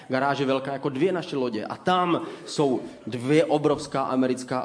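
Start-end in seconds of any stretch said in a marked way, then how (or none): none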